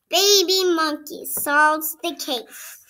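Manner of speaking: ordinary delivery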